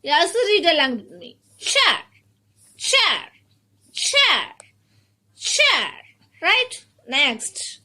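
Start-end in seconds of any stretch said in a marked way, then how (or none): 1.6-5.9 "Chair" joined in one high, falling syllable; blended word. Shown as a demonstration. Teacher leads children reading along, blended "Chair". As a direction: blend "Chair" smoothly, starting high and dropping. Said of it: "say them a little faster".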